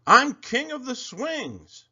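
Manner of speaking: high, slow, loud boast; bully yelling. A narrator's reading. Bully Sammy boasting at Kevin on the swings. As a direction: crow it like the king of the playground. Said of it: "yelled Sammy".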